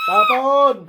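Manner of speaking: loud, forceful order; shouted command. Real, not acted. Healer ordering the spell thrown away, shouted command. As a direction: order it out at full voice.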